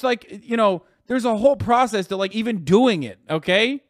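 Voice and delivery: high, loud exasperated yelling; furious rant. Real, not acted. Streamer yelling at chat about how heroin addiction starts, furious loud rant. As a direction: rage at the chat, almost screaming.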